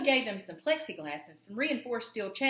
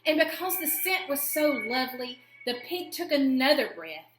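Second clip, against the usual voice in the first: much higher and louder, marvelling; enchanted delight; 7 semitones higher, 5 dB louder than usual. A narrator's reading. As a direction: swoon over the lovely scent. Pig enchanted by flower scent.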